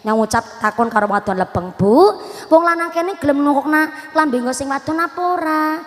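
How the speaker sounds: usual speaking voice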